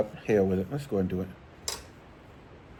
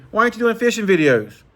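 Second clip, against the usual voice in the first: much higher, louder mock whine; mimicked pestering question; 9 semitones higher, 5 dB louder than usual. Viewers nagging him about fishing videos, mimicked in high voice. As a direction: imitate nagging viewers, pitched way up. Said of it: "I'm tired of people asking me".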